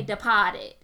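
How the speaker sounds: "Departed" drawn out with no R; dropped R. Shown as a demonstration. Teaching how Boston says it, "Departed" with dropped R. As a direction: slowly say "De-pah-ted" for "Departed". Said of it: "they don't pronounce their R's right".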